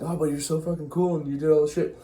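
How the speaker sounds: butter him up in a mocking sweet voice; sarcastic gushing wife, fast and high; mock flattery. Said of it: "So Clytemnestra's like".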